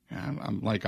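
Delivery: ordinary talk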